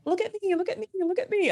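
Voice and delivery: put-on high-pitched eager little kid; childish look at me. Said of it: "I'd be like".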